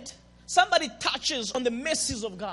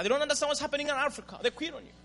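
normal talking voice

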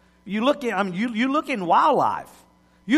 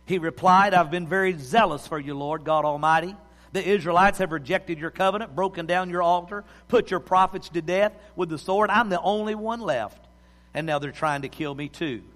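scripture reading; 5 semitones lower than usual. Acted. Pastor reading First Kings to his congregation, in a hushed reverent voice. Reads scripture story aloud.

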